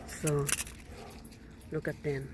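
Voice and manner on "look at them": normal talking voice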